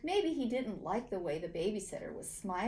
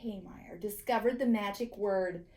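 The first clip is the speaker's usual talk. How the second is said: usual speaking voice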